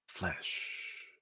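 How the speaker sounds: a low, soft, breathed "Flesh", slowly savoured; a wild boy relishing the word to unsettle a gentleman. Acted. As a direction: taste the word "Flesh" as you say it. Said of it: "pronounced the word with slow relish".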